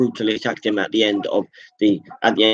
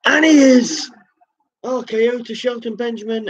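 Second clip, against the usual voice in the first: excited call; 14 semitones higher than usual. Real, not acted. Streamer hyping his own game's finisher, excited cry. Commentator calls the big finisher.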